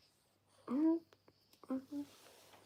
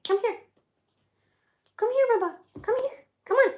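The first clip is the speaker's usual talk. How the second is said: high, raised coaxing calls; calling a dog; 9 semitones higher, 5 dB louder, faster than usual. Real, not acted. Owner calls the dog to come on, high insistent coaxing. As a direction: keep calling the dog in short high bursts.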